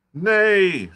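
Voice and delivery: high, loud ranting shout; shouted at the top of his voice. Acted. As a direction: curate bellowing his refusal. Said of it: "shouted the curate at the top of his voice".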